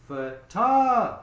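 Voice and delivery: TOG of Photographer high, loud and held; stress on second syllable. Shown as a demonstration. Teacher answering which syllable gets stress, TOG punched in Photographer. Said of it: "The second one".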